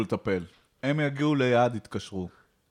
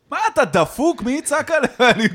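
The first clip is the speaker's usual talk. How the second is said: mock outrage; 8 dB louder than usual. Mocking neighbor's advice to shout.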